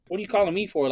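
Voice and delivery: a mom snapping at the coach; lightly voiced irritated mother; imitating mom. Mom answers the coach's call.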